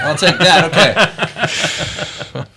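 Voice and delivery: high laughing reply. Real, not acted. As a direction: laugh through the words. Laughs at a remark.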